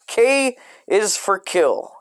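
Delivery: normal talking voice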